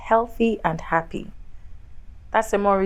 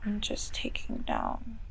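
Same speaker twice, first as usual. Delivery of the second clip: whispered aside; 12 dB quieter, slower than usual. Real, not acted. Therapist murmurs while noting.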